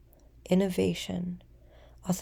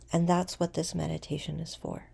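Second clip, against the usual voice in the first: ordinary delivery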